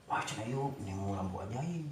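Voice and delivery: normal talking voice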